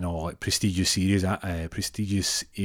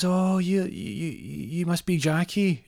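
put-on old lady voice; 10 semitones higher, slower than usual. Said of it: "She comes up, she goes".

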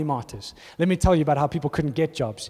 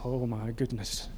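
soft, slow, much quieter awestruck gasp of words; hushed awe; 6 semitones lower, 12 dB quieter, slower than usual. Retold or imitated. Imitating a listener realising the vision, hushed slow awe. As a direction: breathe it out slowly, amazed.

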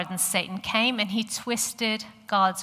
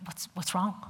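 softly voiced husband, tentative question; imitating puzzled husband; 7 dB quieter than usual. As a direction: ask quietly, as a baffled husband would. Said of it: "He turned to me and he went".

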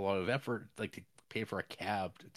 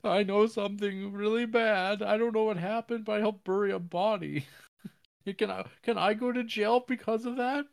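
high, loud mock-weepy confession; mock crying; 12 semitones higher, 5 dB louder than usual. Character confesses to burying a body, mock crying. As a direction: imitate a tearful man wailing in a raised pitch. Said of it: "crying 'cause he's like".

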